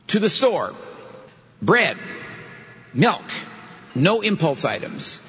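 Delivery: item by item with long pauses; crisp separate items, each left hanging; German-style word order list. Shown as a demonstration. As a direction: dictate a shopping list piece by piece. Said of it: "A German sentence would go like this".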